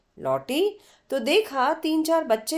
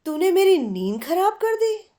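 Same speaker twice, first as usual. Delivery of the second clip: mock complaint; 7 semitones higher than usual. Annoyed at being woken.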